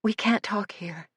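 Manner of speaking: breathless, voiceless whisper; frightened whisper. A narrator's reading. Terrified girl warns Suzanne, whispering. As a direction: whisper it in fear of being heard. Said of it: "almost too frightened to answer".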